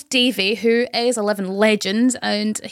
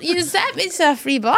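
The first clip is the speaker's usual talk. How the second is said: indignant protest; 7 semitones higher than usual. Retold or imitated. Protesting to angry boss.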